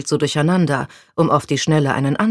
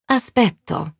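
put-on Italian girl voice; 8 semitones higher than usual. A narrator's reading. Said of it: "sagte sie".

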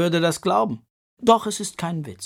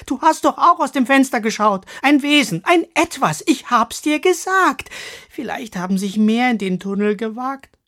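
loud, fast, higher pleading; loud insistence; 7 semitones higher, 7 dB louder than usual. Acted. Katrina insisting loudly to Misses Crabone. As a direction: push hard so she finally believes you. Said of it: "sagte sie laut".

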